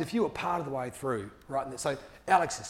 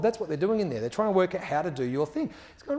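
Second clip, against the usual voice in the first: ordinary delivery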